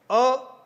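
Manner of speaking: "अ" said short and quick; short a vowel. Shown as a demonstration. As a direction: clip "अ" brief. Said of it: "समय कम लगा". ("अ" का छोटा उच्चारण)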